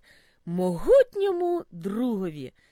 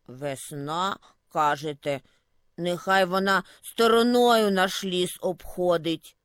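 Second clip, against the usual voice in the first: put-on low grumbling old voice; grumbling snowdrift; 5 semitones lower than usual. A narrator's reading. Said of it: "почулося бурчання снігового замету". (низьке бурчання замету)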